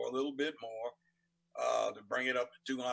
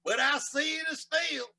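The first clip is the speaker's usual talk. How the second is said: much higher, loud, slow Southern drawl; 11 semitones higher, 6 dB louder, slower than usual. Shown as a demonstration. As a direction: stretch every line into a loud Southern twang. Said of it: "Now, you say it in a Southern drawl".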